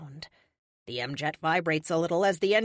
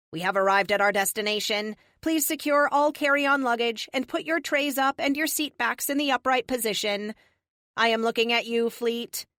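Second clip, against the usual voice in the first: raised, louder cabin announcement voice; mock flight attendant; 4 semitones higher, 4 dB louder than usual. Arrival announcement to the team, mock flight attendant. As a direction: announce it like cabin crew, then single out a passenger.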